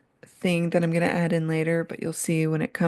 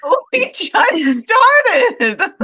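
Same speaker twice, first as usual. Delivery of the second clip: laughing; 11 semitones higher, 7 dB louder, much slower than usual. Real, not acted. Host laughing at Jerry's complaint.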